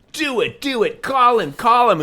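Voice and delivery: high and loud, eager chant of do it and call him; high excited egging on. Retold or imitated. Friends egging him on to call the landlord, high and eager. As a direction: push them on, giddy and insistent. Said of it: "this is the same joke as".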